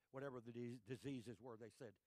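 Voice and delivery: normal talking voice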